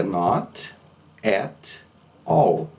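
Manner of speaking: "not at all" slow, a pause after each word; slow, unlinked "not at all". Shown as a demonstration. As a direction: separate "not", "at", "all" with full sounds. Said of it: "não estaria errado".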